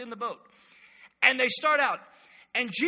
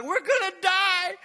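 high, loud panicked yell, drawn out; freaking out; 10 semitones higher, 7 dB louder, slower than usual. Acted. Disciples to each other as the boat sinks, panicked yell. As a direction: cry out in acted panic. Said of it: "the disciples are freaking out".

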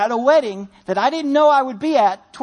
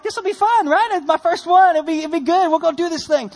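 cheery bravado; 5 semitones higher than usual. Retold or imitated. Excited self-quote before first wedding.

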